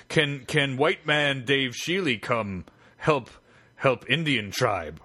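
slow, drawn-out hammed-up chief voice; mock Native chief. Prank caller asks the researcher for help, slow mock chief. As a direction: drag every word like a cartoon chief. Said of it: "heap big skunk ape".

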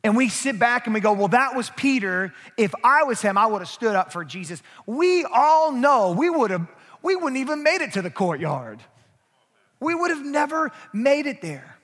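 loud, high, driving preacher climax; preaching at full force. Real, not acted. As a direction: preach it out to the congregation.

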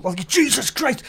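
high, slow startled cry; finding a brown snake behind the motel door; shocked exclamation. Retold or imitated. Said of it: "So I fucking go".

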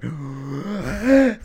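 a big mock gasp for air, high and loud; imitated breathless gasp. Retelling a newsreader out of breath, mock gasping. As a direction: gulp air like a winded presenter. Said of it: "she was, like, out of breath".

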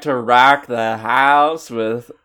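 loud show-host intro; announcer. Real, not acted. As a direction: announce the return, punchy. Show intro after break.

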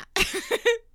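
high-pitched stifled giggle. Real, not acted. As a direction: let a giggle escape. Laugh alone on line.

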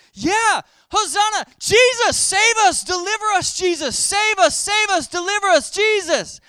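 high, loud shouts of hosanna and save us; crowd shouting. Retold or imitated. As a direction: cry out to him like a desperate throng. Crowd crying out to Jesus.

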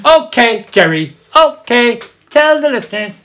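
very high, loud put-on voice; mock character impression. Urging a co-host to tell the listeners, high loud impression. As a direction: call out each phrase high and loud in character.

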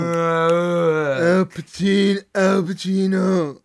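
dinosaur voice. Pretend. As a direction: roar the name as a dinosaur. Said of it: "Al Pacino as a dinosaur".

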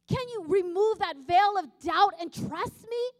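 a touch of a booming divine rebuke; voice of God. Retold or imitated. God's voice retold in testimony.

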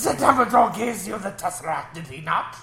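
laughing mock German accent; hammy German accent through giggles. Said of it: "that was my German accent".

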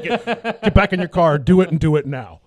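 loud mock cop command; cop mode. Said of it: "talk to me like a cop".

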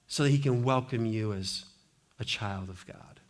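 low, hushed and slowing to soft; tender assurance. Real, not acted. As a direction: let it sink to a gentle hush. Tender resolution after the climax.

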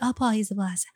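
不好意思 in a soft Taiwanese accent; 不好意思 said the Taiwanese way, laughing. Shown as a demonstration. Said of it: "in, in my Taiwan accent".

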